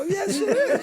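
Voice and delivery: high, loud, laughing yes; laughing proud agreement. Retold or imitated. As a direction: beam and laugh it out.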